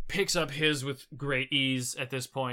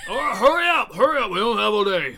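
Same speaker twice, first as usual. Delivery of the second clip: high, loud, urgent holler; impatient shout; 8 semitones higher, 10 dB louder than usual. Acted. Lifter hurries helpers who are dawdling, impatient shout. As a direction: yell at them to get a move on.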